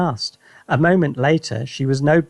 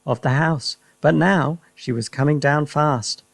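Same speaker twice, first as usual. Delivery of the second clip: ordinary delivery, nothing marked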